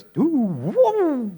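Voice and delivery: high siren wail; mock siren noise; imitated siren wail.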